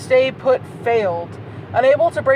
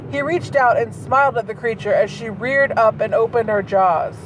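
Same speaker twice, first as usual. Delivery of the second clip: usual speaking voice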